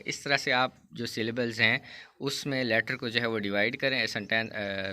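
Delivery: usual speaking voice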